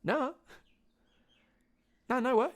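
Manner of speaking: very high-pitched little denial; mock innocent denial. Kid lying to dad about the microwave, mock innocent denial. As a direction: deny it in a squeaky-high guilty voice.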